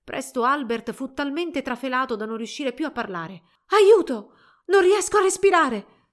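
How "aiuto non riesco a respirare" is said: very high, loud desperate cry; frantic, raised-pitch plea; panicked cry for help. A narrator's reading. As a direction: shout as if the air is running out. Albert breathless, cries for help.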